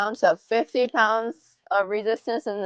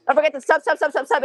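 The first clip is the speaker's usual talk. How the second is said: hyped-up plug; 6 semitones higher, faster than usual. Real, not acted. Same plug, redone after a rant.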